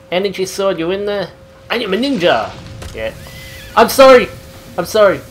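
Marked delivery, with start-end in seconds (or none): 3.7-5.2 loud, high, panicked sorry; shouted apology. Real, not acted. Yelled apology during fight.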